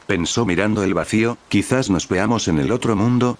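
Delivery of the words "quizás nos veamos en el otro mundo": plain reading voice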